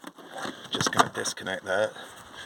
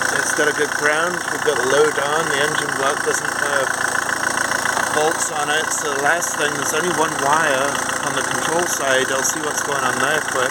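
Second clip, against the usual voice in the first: raised voice over a running engine; shouting over engine; 4 semitones higher, 4 dB louder than usual. Real, not acted. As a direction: project loudly over engine noise. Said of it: "I don't know if you can hear me".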